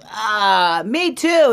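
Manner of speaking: high, loud sighing agreement; weary Mama Bear. Acted. Mama Bear agrees to rest, high sigh. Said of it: "And Mama Bear goes".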